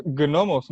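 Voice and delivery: "gnomos" with the G sounded hard; hard G pronunciation. Shown as a demonstration. Co-host opening a video on gnomes, sounded hard G. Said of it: "no se pronuncia así".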